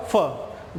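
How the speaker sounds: "फ" as the short sound of F, low; letter-sound demo. Shown as a demonstration. Teacher answering own question on F's pronunciation, letter-sound demo. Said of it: "एफ का pronunciation क्या होगा?".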